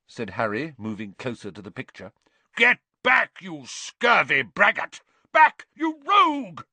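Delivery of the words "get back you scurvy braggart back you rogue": high, loud shout of outrage; furious knight. A narrator's reading. Fallen knight warns Harry away from him, shouting furiously. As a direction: bellow grandly as a blustering knight.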